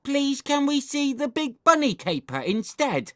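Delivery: put-on very high, piping child voice; pleading little brother. A narrator's reading. Little brother begging for bunny film, high pleading. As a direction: plead sweetly in a squeaky voice.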